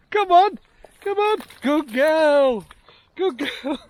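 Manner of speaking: high squeaky dog praise; squeaky praising a dog. Real, not acted. Praising dog after crossing.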